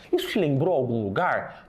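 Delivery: normal talking voice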